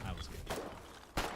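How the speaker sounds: ordinary delivery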